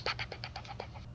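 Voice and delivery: ordinary delivery, nothing marked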